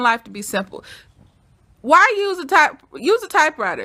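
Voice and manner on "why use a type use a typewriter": high, loud mock-mom challenge; mock exasperated mom. Imitating mom who wants everything simple, mock exasperation. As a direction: push it out like a fed-up mother. Voicing the exasperated mom's demands.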